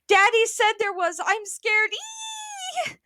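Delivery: high scared squeal. A narrator's reading. Mother too scared to listen, squealing. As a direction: squeal in fright, ending on a long eek. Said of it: "but continued to squeal".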